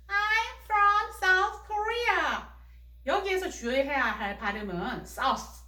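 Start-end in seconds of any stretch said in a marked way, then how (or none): 0.1-2.5 put-on much higher, very slow child voice answering; 높은 아이 목소리. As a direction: answer slowly in a high little-girl voice. (미미 역할로 높고 천천히 대답하는 목소리)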